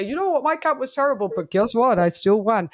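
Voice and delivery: a mild mock flex by the winning boxer; boastful flex. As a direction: gloat that you won anyway. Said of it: "and then they say".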